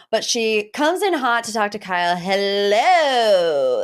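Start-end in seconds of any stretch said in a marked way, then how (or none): 2.1-3.8 louder, drawn-out mock greeting; a cool, pointed hello; imitating Dorit. Host imitating Dorit greeting Kyle, mock icy. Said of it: "That's what she says".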